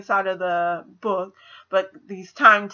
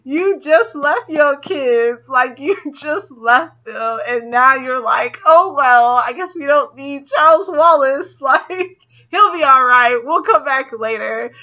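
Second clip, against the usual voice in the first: slow, louder, higher, teary disbelief; exasperated; 5 semitones higher, 8 dB louder, slower than usual. Retold or imitated. Viewer scolding the movie dad, teary exasperation. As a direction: scold him half-crying, half-laughing. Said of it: "I was like".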